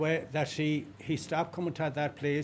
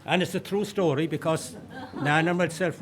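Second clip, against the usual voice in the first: laughing; 4 semitones higher than usual. Real, not acted. Laughing after finishing the story.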